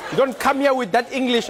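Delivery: loud, high-pitched mock scolding from dad; hammed-up angry dad; scolding dad impression. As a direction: tell them off as an indignant accented father. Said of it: "your dad would be like".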